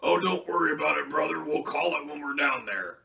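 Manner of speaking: loud, high Hogan impression; Hulk Hogan voice. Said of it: "something that Hogan was notorious for".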